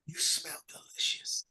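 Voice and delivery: slow, hushed whisper; real soft. Retold or imitated. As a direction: murmur it softly and slowly, up close. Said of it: "he goes real soft".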